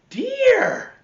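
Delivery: high, loud cry of dear; dad calls out in alarm; dad's alarmed call. Acted. Dad calls to mom fixing the toilet, high and loud. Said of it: "said his dad".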